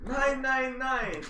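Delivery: slow, high mock German "Nine nine nine". Player hamming a German accent for the table, slow and high. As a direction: ham up a German no. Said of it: "You're German now".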